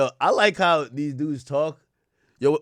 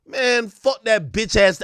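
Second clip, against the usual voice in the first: higher, louder, drawn-out mock diss; mock angry insult; 7 semitones higher, 5 dB louder, slower than usual. How rappers never address [name], mock angry. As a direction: imitate a rapper cussing someone out, slow and loud.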